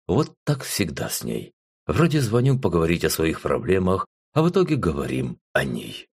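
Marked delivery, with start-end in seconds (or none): none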